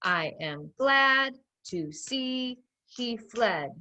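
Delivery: very slow, one beat per word; pounded out with the class. Shown as a demonstration. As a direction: lead the children beat by beat. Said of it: "Pound it with me".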